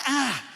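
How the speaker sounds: sharp loud ouch; pained cry. Retold or imitated. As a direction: yelp as the leg gives. Pain while trying walk.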